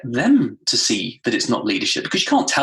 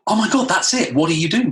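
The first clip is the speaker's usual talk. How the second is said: high gasp then excited realisation; higher, thrilled discovery; eureka moment; 6 semitones higher than usual. Retold or imitated. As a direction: gasp and light up at the idea. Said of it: "that was the moment I thought".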